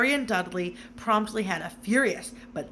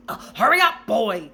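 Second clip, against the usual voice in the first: sharp, loud and higher call from the kitchen; impatient shout; 3 semitones higher, 10 dB louder than usual. A narrator's reading. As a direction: bark the order across the house. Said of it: "shouted Uncle Vernon".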